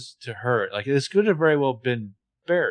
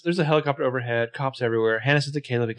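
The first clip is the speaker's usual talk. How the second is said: normal talking voice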